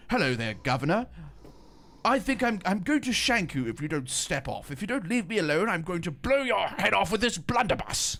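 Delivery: hammed-up East London Cockney, pitched high; Dagenham accent. Mocking how Hackney or Dagenham talks, hammed-up East London accent. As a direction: threaten them in an exaggerated Dagenham accent. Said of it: "Dagenham and it's like".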